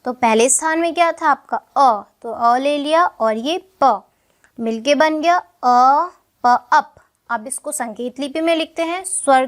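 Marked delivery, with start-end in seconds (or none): 1.6-7.0 slow spelling out. Shown as a demonstration. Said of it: "teacher spells out sounds slowly".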